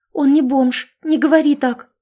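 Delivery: woman frightened, defending her master; frightened outcry. A narrator's reading. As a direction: cry out in fear to defend him. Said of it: "испуганно воскликнула Жанна".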